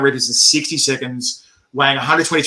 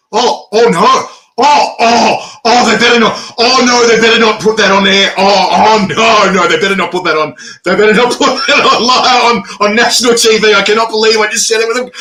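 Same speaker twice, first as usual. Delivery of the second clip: loud, higher, acted-out dismay; mock panic; 5 semitones higher, 4 dB louder than usual. Competitor realising the microphone was still on, panicked. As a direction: replay the horror, voice raised and tumbling.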